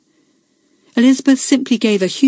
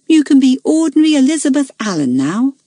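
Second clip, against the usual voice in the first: put-on higher, kind girlish reassurance; kindly; 8 semitones higher, 4 dB louder than usual. A narrator's reading. Belinda consoling Elizabeth after she stops being monitor, higher kind girl voice. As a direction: gently cheer her friend up. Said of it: "said Belinda kindly".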